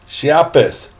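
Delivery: plain reading voice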